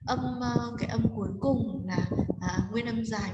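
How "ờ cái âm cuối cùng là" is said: normal talking voice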